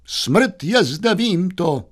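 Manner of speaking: high, slow mock-tragic announcement; theatrical declaiming. Youth answering the maid, high slow mock-tragic declaiming. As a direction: announce your doom grandly and high.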